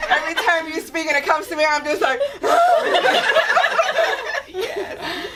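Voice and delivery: fast, high words broken by laughter; laughing while moved. Real, not acted. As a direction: talk through happy laughter, almost crying.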